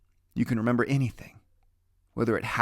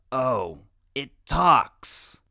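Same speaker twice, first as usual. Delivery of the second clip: put-on smart little kid sneering at the giant; boy genius voice; 5 dB louder, much slower than usual. Boy genius to the big kid, mildly mocking. Freak speaks first, mocking.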